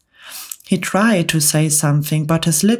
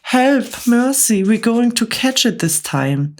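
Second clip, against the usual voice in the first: high, frantic cries for mercy; panicked wail; 7 semitones higher than usual. Acted. Stuck centipede wailing at the storm cloud, high and panicked. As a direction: beg for mercy in terror.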